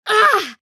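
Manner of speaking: sharp high cry of pain. Real, not acted. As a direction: yelp as if struck. Hit in combat.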